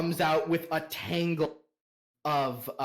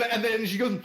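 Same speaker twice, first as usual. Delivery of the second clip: normal talking voice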